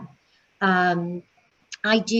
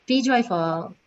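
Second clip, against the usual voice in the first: ordinary talk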